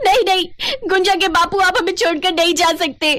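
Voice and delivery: high-pitched, panicked wail of protest; distraught crying out. Acted. Wife reliving her husband's death, high distraught cry. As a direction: cry out in anguish at losing someone. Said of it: "वो जोर जोर से चिल्लाने लगी".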